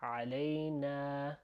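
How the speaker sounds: "علينا" with a long final a; stretched A. Shown as a demonstration. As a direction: draw out the "na" in "علينا". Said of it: "stretching that A sound".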